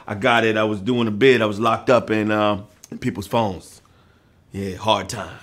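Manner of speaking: mock tough guy. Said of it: "J K".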